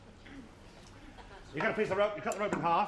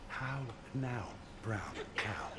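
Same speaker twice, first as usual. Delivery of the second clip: hushed, breathy whisper, much lower and softer; 7 semitones lower, 10 dB quieter, much slower than usual. Retold or imitated. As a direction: barely breathe the words out like a doctor testing hearing. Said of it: "I'm gonna whisper something to you".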